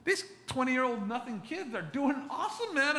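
high-pitched disbelief with a laugh; astonished. Retold or imitated. Pastor retells his amazement at the young couple's faith, high laughing disbelief. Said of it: "I'm looking over going".

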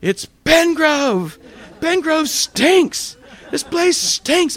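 complaining. Retold or imitated. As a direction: whine about the whole town stinking. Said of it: "And I'm just complaining".

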